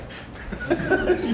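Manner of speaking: high breathy laugh. Real, not acted. Laughing at his own recovery.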